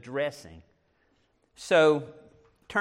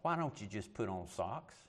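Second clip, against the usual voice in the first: soft, low, gentle suggestion; 7 semitones lower, 12 dB quieter than usual. Retold or imitated. Preacher voices the Lord answering his socks complaint, very gently. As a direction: quietly voice a kind nudge. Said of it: "the Lord just very gently said".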